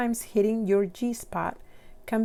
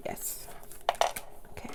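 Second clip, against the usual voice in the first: ordinary delivery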